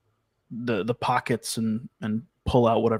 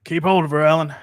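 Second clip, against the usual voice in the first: urgent call; 4 semitones higher, 7 dB louder, faster than usual. Acted. Urgent order in rescue.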